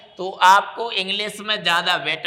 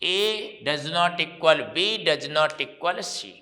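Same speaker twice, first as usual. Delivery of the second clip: each word drawn out, very slow; very slow dictation; much slower than usual. Shown as a demonstration. Teacher dictating the rule very slowly to the class. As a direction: lay every word down slowly for students to copy. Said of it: "तीन आपको एक जैसा लिख देना है".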